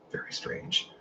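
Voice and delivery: soft unvoiced whisper of the strangeness. Real, not acted. Vlogger whispers an aside to his viewers, hushed whisper. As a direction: lean in and breathe it to the viewers.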